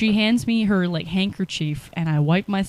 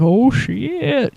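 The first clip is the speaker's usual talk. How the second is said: higher, louder alarmed exclamation; startled "oh shit"; 6 semitones higher, 7 dB louder than usual. Retold or imitated. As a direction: react to an angry bear. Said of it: "And I'm like".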